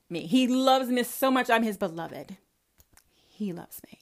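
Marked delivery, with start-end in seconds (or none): 3.3-4.0 mock whisper. Mocking believer's smug boast.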